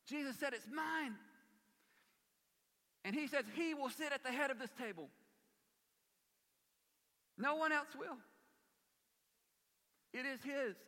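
high, loud, ringing sermon climax; fervent pulpit preaching; full-force preaching. Acted. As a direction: preach it out to the congregation.

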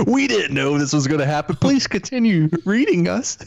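high, laughing fake-sorry plea; mock pleading magazine. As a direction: play the groveling publication, hammed up. Mocking The Economist's apology.

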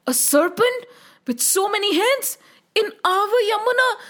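high, frightened disbelief; scared children. A narrator's reading. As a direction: echo the news like alarmed children. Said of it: "The children got very scared".

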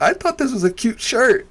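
squeaky-high plea breaking into laughter; mock-wounded protest. Comic retelling appeal to audience, mock-wounded.

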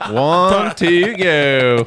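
put-on Southern accent. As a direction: stretch it out in a thick Southern accent. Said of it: "dirty Southerner from the U S".

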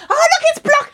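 high, loud squeal of recognition; excited squeal. Retold or imitated. Spotting her hometown on a bus, loud excited squeal. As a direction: squeal it out like a delighted kid. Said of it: "So I was really like".

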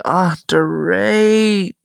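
long, loud drawn-out groan of a name; playfully exasperated scolding; mock exasperation. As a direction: stretch the name out in exasperated protest. Acting out exasperation at Dorit.

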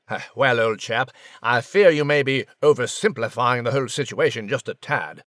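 put-on tactful, stuffy old-chap English voice; British gentleman mouse. British gentleman tells Max he's oversimplifying, stuffy and tactful. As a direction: gently correct him as a pompous English gentleman. British character tempers Max's theory.

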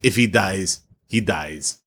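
a hint of a cold Russian boxer; low, deadpan movie-villain line; Drago quote. Friend quotes Rocky villain to cohost, lightly voiced deadpan.

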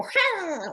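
sudden sharp high "Ah" of pain; pained cry. Shown as a demonstration. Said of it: "like this".

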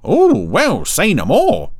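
high, loud, swooping innuendo; insinuating Brian. Acted. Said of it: "suggested he had a great deal more to say".

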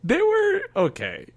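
high, drawn-out grudging "okay"; squeaky-high reluctant half-agreement; doubtful concession. Real, not acted. Disputing that drawings were good.

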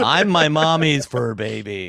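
slow, drawn-out cutesy voice; mock pampered pet. Co-host teasing about fur babies, slow cutesy pet voice. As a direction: play the doted-on pet, dragging it out.

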